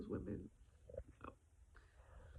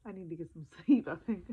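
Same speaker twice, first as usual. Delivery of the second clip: laughing, a little louder, after crying; laughing through tears; 8 dB louder than usual. Real, not acted. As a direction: laugh it off while still teary. Laughing at her own tears.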